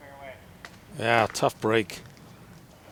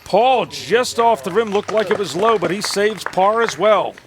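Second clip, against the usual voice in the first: higher, louder live call; excited play-by-play; 9 semitones higher, 9 dB louder than usual. Real, not acted. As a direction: call it big and fast.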